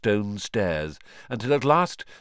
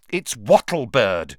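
impatient; 2 semitones higher, 7 dB louder, slower than usual. A narrator's reading. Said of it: "said Hermione impatiently".

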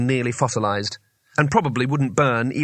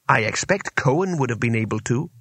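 usual speaking voice

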